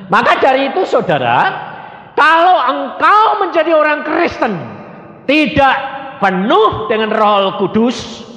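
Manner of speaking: high, drawn-out exhortation; impassioned preaching. Real, not acted. Preacher urging the congregation to believe, fervent exhortation. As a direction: preach it with ringing conviction, slowly.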